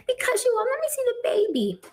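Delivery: high, whiny imitation of a crying mother; mock crying mother. Mother-in-law complaining to her son, in a high mock-crying whine. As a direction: whimper it like a mother-in-law faking tears. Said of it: "why are you crying?".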